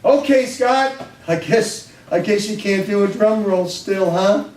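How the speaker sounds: put-on teacher ribbing the boy about drum roll; teasing teacher. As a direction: needle the kid playfully.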